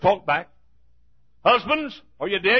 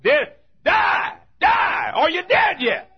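higher, louder shout with a cry in it; full-force preaching; 8 semitones higher, 3 dB louder, slower than usual. Acted. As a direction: cry it out from the pulpit. Preaching climax.

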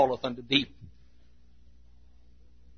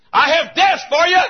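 high-pitched preacher's shout; acted high shout from the world; 7 dB louder than usual. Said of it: "The world shouts and says".

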